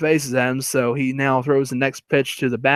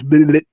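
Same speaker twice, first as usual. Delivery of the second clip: mocking bleh; 3 semitones higher, 7 dB louder than usual. Henry making faces at the first baseman, mocking bleh. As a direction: blurt a silly tongue-wagging noise. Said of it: "making faces again. This time goes".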